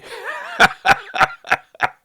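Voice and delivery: high, loud burst of laughter. Real, not acted. Ex-inmate recalling an agent who disliked him, laughing loudly. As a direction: burst out in a long high cackle.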